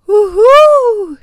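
high, loud hooting owl call. A narrator's reading. Owl Oline hoots to show the feathers, high hoot. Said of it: "tudede Oline".

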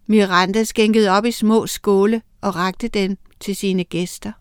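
usual speaking voice

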